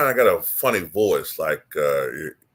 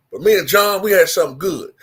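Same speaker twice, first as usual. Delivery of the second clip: Karl Malone twang; 6 semitones higher, 5 dB louder than usual. Shown as a demonstration. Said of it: "country twang to his voice".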